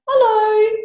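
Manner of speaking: very high squeaky little hello; tiny flea voice. Acted. Flea greets Bear in a tiny high squeak.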